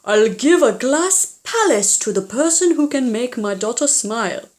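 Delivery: lightly voiced father announcing. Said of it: "her father announced".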